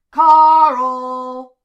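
very loud, long holler; calling out. A narrator's reading. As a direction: bellow a name across a crowded hall. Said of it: "hands cupped to his mouth".

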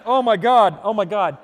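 mock panic, high and loud; panicked. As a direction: blurt it out in embarrassed horror. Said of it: "And I'm like".

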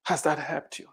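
quiet echo of the question. Acted. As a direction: drop the level and let it sink in. Challenging the complainers.

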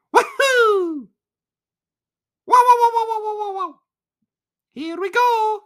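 very high, loud, squeaky Mario voice; hammed-up Mario cheer; Mario impression. Podcaster mocking the Mario voice, very high and loud. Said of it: "I don't wanna hear".

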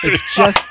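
squeaky goofy high noise; a silly high squeal. Pretend. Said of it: "making a goofy noise".

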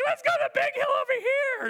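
put-on high, loud excited kid shout. Kids calling each other to the big hill, excited high shout. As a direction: holler like a kid racing off.

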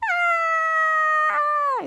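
long, very high held mock scream; acted-out high-pitched shriek. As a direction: scream on one sustained high note. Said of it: "And they're screaming".

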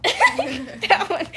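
laughing through the words. Real, not acted. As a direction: giggle it out. Laughing after prank call.

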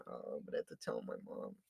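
whispered aside under breath. Real, not acted. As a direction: whisper it to yourself.

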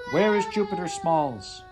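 high, loud screamed demand; screaming. Acted. Heather screaming at Kyle, furious. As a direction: scream it into his face. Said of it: "She was screaming at him".

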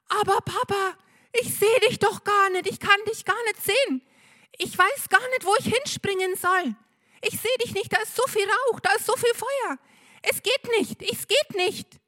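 put-on very high, frantic child voice; crying, screaming child. A narrator's reading. Benno cries down to his father, very high and frantic. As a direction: plead through the smoke in panic. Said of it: "Der Benno weint und schreit".

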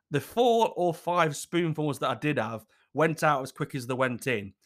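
usual speaking voice

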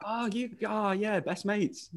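high, soft feigned nonchalance; hushed, high-pitched fake best-mate act; mock casual brag. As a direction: pretend you're old pals, airily.

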